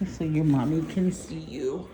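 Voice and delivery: ordinary talk, nothing marked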